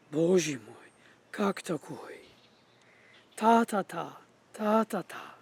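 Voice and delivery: mock Russian speech, first beats punched; Russian accent, first-syllable stress; Russian emphasis. Shown as a demonstration. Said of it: "with emphasis is on the first syllable".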